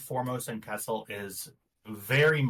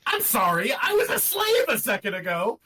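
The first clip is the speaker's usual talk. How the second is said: high, loud laughing protest; laughing shout; 9 semitones higher, 6 dB louder than usual. Real, not acted. Laughing defence of in-character line.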